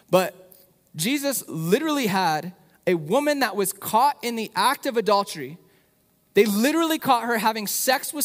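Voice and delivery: rising passion, pushing the story out loud; passionate. Real, not acted. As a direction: preach it with real fire. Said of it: "I'm getting, like, passionate about this".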